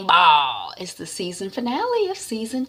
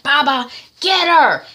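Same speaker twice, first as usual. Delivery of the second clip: loud, higher command; angry order; 9 semitones higher, 8 dB louder than usual. Retold or imitated. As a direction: send Baba after her. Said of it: "wifey is like".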